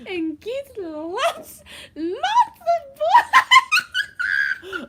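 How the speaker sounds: put-on high, loud, slow French accent with laughter; hammed-up French accent, breaking into giggles. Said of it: "answer a question in a French accent".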